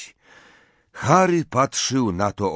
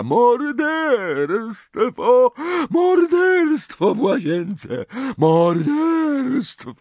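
very high, loud, slow ghostly scream; shrieking; 5 dB louder, slower than usual. A narrator's reading. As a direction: scream it through the halls. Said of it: "Jęcząca Marta wrzasnęła".

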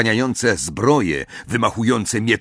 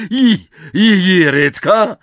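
higher, louder stuttering question; stammering; 9 semitones higher, 7 dB louder than usual. Acted. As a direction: stumble over the first word. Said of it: "wyjąkał Filcz".

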